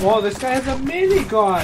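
high, loud burst of surprise; alarmed callout. Real, not acted. Warning teammates that an enemy has a minigun, alarmed. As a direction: call it out high and startled. Spots enemy weapon mid-firefight.

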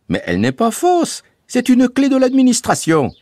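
Bouzig's voice. A narrator's reading. Bouzig reassuring the family, bright boyish voice. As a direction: play the cheerful young guide Bouzig.

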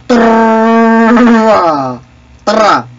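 loud, high, drawn-out trill; mock gunfire noise. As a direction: let rip a long pretend machine-gun burst. Long imitated gunfire.

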